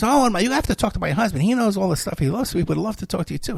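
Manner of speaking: mock eager wife; gushing caller. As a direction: gush like an excited caller. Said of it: "And she goes".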